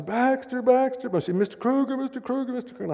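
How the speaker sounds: high, urgent calling of a name; young woman calling out. Retold or imitated. Young woman running down the aisle calling her teacher, voiced high. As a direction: call after him, high and pleading. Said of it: "she says".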